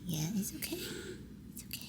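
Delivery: gentle unvoiced whisper to a kitten; calm the scared kitten, barely voiced; soothing whisper. Real, not acted. Owner settling the frightened new kitten, soothing whisper.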